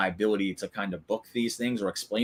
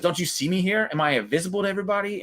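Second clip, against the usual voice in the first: retold annoyed complaint, pitched up; mock put-out voice, higher and rushing at the end; 8 semitones higher, faster than usual. Finn cutting into a backstage interview, ignored and annoyed. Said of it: "he gets annoyed".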